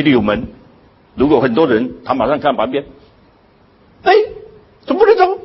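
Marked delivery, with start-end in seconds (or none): 4.0-5.4 high, loud protest; mock outraged pushy patron; indignant queue jumper. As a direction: act out someone shoving past and complaining. Acted indignant person at a door.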